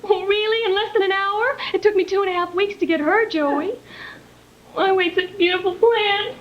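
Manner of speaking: fighting back tears over ruined plans; close to tears. Acted. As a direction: voice high and strained, on the verge of crying. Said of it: "what are you starting to cry for".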